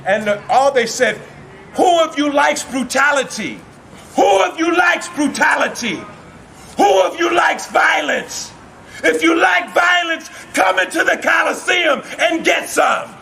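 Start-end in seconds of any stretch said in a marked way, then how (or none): 1.7-13.1 high-pitched, ringing arena challenge; shouted challenge. Acted. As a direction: dare the whole crowd. Beasts challenge the Colosseum crowd.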